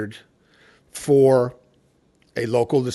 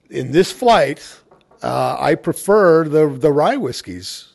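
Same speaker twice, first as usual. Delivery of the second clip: slow and louder, each word weighed; slow deliberate verdict; 4 semitones higher, 6 dB louder, slower than usual. Retold or imitated. Judge telling fellow judges his pick, slow and emphatic. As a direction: lay out the surprising verdict carefully. Retold statement at judging.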